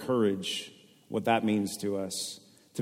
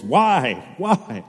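loud, rising cry of why; anguished cry; 4 semitones higher, 7 dB louder than usual. Retold or imitated. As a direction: cry out the question to God. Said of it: "the anthem of this song is".

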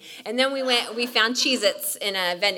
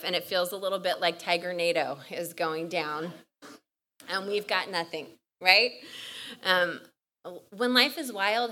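normal talking voice

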